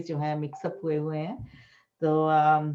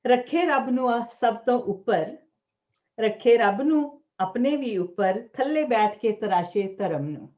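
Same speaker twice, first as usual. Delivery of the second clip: higher-pitched, measured declaiming of verse; poetry recital; 6 semitones higher, 5 dB louder than usual. Acted. Poet urging listeners on God and faith, raised recital voice. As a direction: lift the line openings and pause between phrases. Recites own Punjabi poem.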